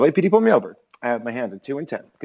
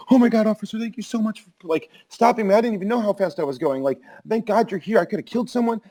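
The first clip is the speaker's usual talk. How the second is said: high, overdone thankfulness to a cop; gushing mock gratitude; 10 semitones higher than usual. Speeder sucking up to the cop who pulled him over, high gushing mock gratitude. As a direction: gush over the officer like he saved your life. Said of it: "making them to be a hero".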